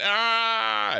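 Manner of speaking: high, strained imitation of the yell; mock yell. Streamer copying Rastov's slowed-down yell, high mock squeal. Mimicking the slow-motion yell.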